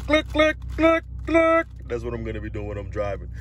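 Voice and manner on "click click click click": click, click in a high squeaky voice. Pretend. Squealing out the clicks while pretending to shift gears.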